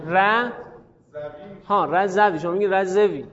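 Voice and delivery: ordinary delivery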